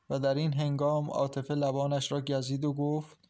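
ordinary delivery, nothing marked

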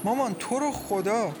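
high, distressed plea; pleading. A narrator's reading. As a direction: implore a distraught mother. Daughter begs her mother.

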